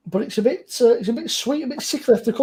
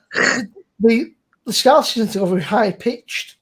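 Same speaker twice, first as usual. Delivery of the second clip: ordinary talk, nothing marked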